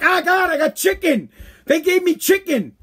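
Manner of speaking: hammed-up aggrieved caller; mock outraged complaint. Customer calls someone to complain about getting chicken, mock outraged.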